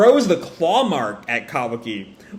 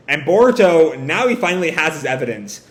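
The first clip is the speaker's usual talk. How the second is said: ordinary delivery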